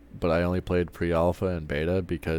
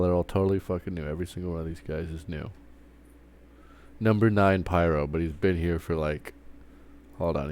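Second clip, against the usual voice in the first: ordinary delivery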